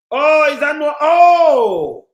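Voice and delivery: loud, startled exclamation; surprised shout. Real, not acted. Host hearing something shocking, shouting in surprise. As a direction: burst out in disbelief. Reacting to caller's claim.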